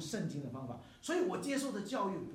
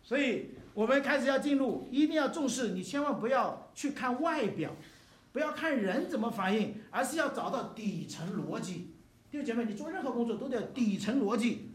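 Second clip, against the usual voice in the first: loud, urgent sermon voice, pressing each point; fervent preaching; 6 semitones higher, 6 dB louder than usual. Acted. Pastor urging the brothers and sisters, loud fervent preaching. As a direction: preach it out full force to the church. Pastor exhorts congregation at full force.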